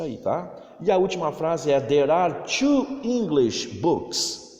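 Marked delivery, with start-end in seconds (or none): 1.8-4.5 slow, careful English sentence for repeating; teacher modelling a sentence slowly; slow model reading. Shown as a demonstration. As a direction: read it out slowly so pupils copy. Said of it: "Pratique a pronúncia".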